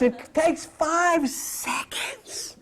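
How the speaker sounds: slow, breathy secret-telling whisper; whispered promise; stage whisper. Real, not acted. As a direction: lean in and whisper the selling point. Stage whisper selling the technique.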